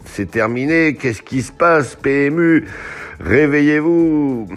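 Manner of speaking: high, loud, drawn-out call; mock wake-up call. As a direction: rouse a sleeper, stretching the last words. Mock wake-up call to the betting operator.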